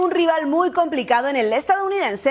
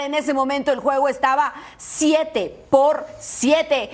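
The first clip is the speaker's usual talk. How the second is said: normal talking voice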